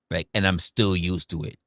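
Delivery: ordinary talk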